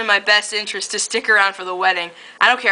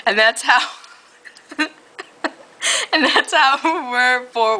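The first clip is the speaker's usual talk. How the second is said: laughing; 4 semitones higher, much slower than usual. Real, not acted. Reader laughing over ending.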